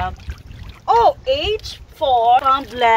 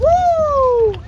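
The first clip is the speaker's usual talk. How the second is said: high cheering "woo"; excited woo; 13 semitones higher, 4 dB louder than usual. Real, not acted. Parent cheers child over the basketball, high excited woo. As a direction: whoop high, drawing it out.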